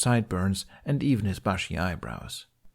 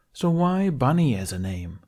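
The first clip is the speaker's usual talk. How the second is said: cheeky, higher question; teasing young man; 6 semitones higher than usual. A narrator's reading. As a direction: tease him with a grin. Jack teases Bunny.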